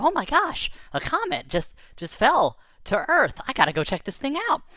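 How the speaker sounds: high, loud, rushing to go; eager to chase it; excited. Retold or imitated. Kid deciding to find the comet, high and excited. Narrator voicing own excitement.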